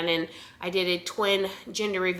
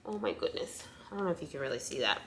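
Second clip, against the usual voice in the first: hushed; 4 semitones lower, 11 dB quieter, faster than usual. Real, not acted. Hushed over ultrasound pictures.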